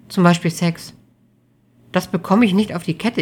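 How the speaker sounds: a hint of a distressed girlfriend; troubled young woman. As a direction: confess haltingly, then insistently. Amelie's voice in dialogue.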